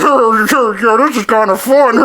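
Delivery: high, loud goofy dad voice; mocking dad impression. Son mimics dad goofing around on the ride, mocking impression. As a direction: put on a gleeful, garbled dad voice. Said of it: "This is what he did. He was like".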